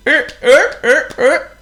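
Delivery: high, loud honking er-er; seal honk. Acted.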